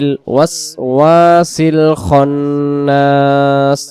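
slow, drawn-out tajweed chant; Quran recitation. Acted. Teacher reciting the full surah for students, slow tajweed chant. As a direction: recite each verse slowly, stretching the vowels.

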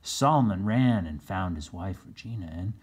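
usual speaking voice

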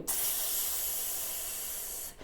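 «цс» as a voiceless hiss through closed teeth, palm at mouth; unvoiced ts hiss. Shown as a demonstration. Teacher demonstrating to children, voiceless «цс». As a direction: breathe out «цс» through clenched teeth, no voice. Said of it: "Зробили вдих носом".

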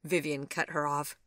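usual speaking voice

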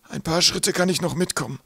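lightly voiced high-pitched professor; professor's voice. A narrator's reading. Professor offering to come a few steps, high-voiced. Professor's character voice in narrated story.

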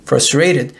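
plain reading voice

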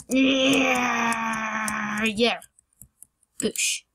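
yelling yeah in delight; excited cheer. Real, not acted. Success cheer after jump.

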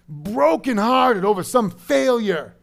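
loud, high preaching that builds; full-force preaching. Real, not acted. Preacher telling the flock God meets them in failure, full-force preaching.

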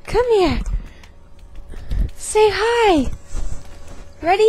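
talking to the dog. Real, not acted. Calling the dog over.

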